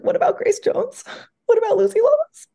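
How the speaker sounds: high-pitched plaintive whine; squeaky, hammed-up protest; mock wailing dismay. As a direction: wail it in comic despair. Acted dismay at character's mock-up.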